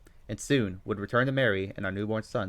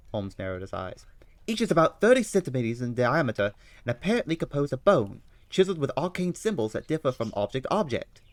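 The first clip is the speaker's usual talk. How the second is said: put-on high, loud London-accented Holmes; eager detective reading the news aloud; 4 semitones higher, 6 dB louder than usual. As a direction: play Holmes, keen and bright over the paper.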